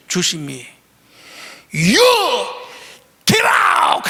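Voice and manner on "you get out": high, loud ejection yell; umpire shouting. Retold or imitated. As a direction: throw him out with a big shout. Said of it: "하는 거야".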